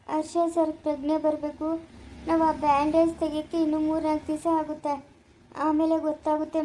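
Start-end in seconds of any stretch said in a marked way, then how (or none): none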